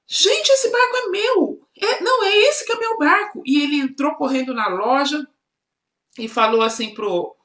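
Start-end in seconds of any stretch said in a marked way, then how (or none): 0.1-3.4 put-on boy recognizing his stolen boat in a shop; excited recognition. A narrator's reading. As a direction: burst out with excitement at the find. Boy spots his lost boat.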